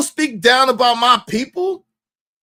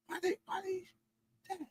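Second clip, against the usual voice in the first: high, quiet questioning son voice; 10 semitones higher, 20 dB quieter than usual. Retold or imitated. Son questioning his dad about the women, high soft imitation. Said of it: "my son bringing the mind to".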